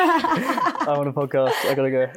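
laughing, high and loud; can't hold the laugh in. Real, not acted. Ending call, laughing.

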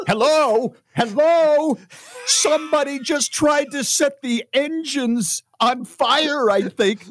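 high, frantic, frazzled pilot voice; panicked pilot. Pretend. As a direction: babble like a pilot losing it. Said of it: "what it would sound like if Bob and Sherry were the pilot and co-pilot".

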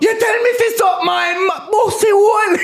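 very high-pitched furious mock shout; acted outraged shriek. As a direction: explode into a shrieking tirade, play-acted. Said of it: "if you said to a man".

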